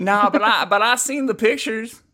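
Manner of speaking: high, hammed-up Texan twang; Western accent. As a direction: play along as a cowboy. Answers in Western drawl.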